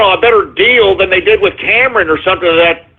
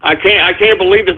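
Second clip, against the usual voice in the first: ordinary talk, nothing marked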